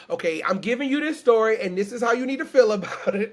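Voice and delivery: mock laying down the law to listeners; bossy preacher. Storyteller mocking a preachy version of herself, bossy and loud.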